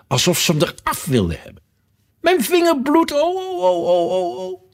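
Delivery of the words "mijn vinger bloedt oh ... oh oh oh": very high, loud squealing wail; shrieking. A narrator's reading. The queen screams about her bleeding finger, shrieking. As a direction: shriek it like a train whistle. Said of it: "Haar gilletjes leken zo ontzaglijk".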